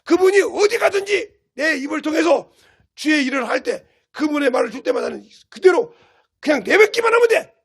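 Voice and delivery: loud, rising, forceful preaching; fervent preaching. Real, not acted. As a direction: drive it home to the congregation. Preaching climax.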